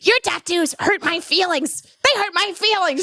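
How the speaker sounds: high-pitched mock mom complaint; upset mom. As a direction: imitate a mother wailing over hurt feelings. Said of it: "and her mom's like".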